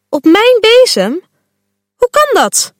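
shocked girl hearing bad news; geschrokken. A narrator's reading. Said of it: "Fuchsia schrikt ervan". (geschrokken, hoog en ongelovig)

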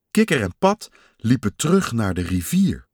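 plain reading voice

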